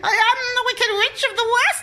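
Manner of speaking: high, loud cackling witch voice; wicked witch voice. Pretend. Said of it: "my evil, evil witch laugh".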